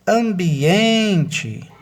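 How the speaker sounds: "ambiente" high and loud, EN drawn out; ambiEEENte, slow and forceful; stress on EN. Shown as a demonstration. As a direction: stretch the "en" in "ambiente" emphatically. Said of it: "o em".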